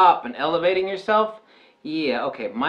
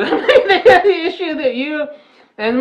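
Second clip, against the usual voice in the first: laughing; 14 semitones higher, 9 dB louder, slower than usual. Real, not acted. Repeats the phrase while laughing.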